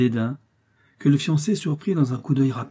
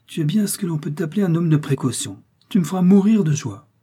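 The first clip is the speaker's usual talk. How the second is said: high, loud amused exclamation; hilarity; 6 semitones higher, 5 dB louder than usual. A narrator's reading. Prince teasing his steward between laughs, highly amused. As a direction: voice raised as if recovering from laughter. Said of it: "s'écria-t-il entre deux éclats de rire".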